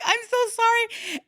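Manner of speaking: very high, squeaky mock apology; retold apology. Apologizing to the patrol after wasting their time, mock squeak. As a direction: plead forgiveness in an acted high voice. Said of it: "I'm like".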